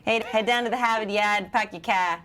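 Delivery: attempted Boston accent, dropped r's; bad Boston accent. Shown as a demonstration. Host showing off Boston accent after Accent Police segment, badly faked. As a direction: have a clumsy go at a Boston accent. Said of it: "do the Boston accent".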